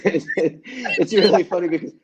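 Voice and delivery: laughing while talking. Real, not acted. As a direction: laugh your way through the line. Laughing while telling the story.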